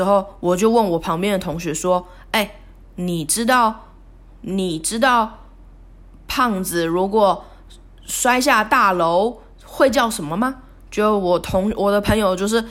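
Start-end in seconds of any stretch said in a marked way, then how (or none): 2.3-10.6 drawn-out, teasing joke question, taken slow; slow teasing setup. Retold or imitated. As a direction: stretch it out to build the punchline. Retelling a mean fat joke setup. (慢悠悠地卖关子)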